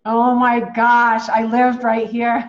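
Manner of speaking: drawn-out real wonder, loud and slow; real excited disbelief. Real, not acted. Finding her old home on the map, amazed and loud.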